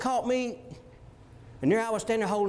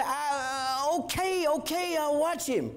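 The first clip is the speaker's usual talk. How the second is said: reluctant grandpa; 4 semitones higher, slower than usual. Retold or imitated. Grandpa reluctantly agrees to babysit.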